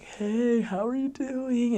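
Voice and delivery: high, drawn-out chummy hello; over-friendly greeting. Retold or imitated. Missionaries trying to befriend the husband, exaggerated friendly greeting. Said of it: "just trying to be friends".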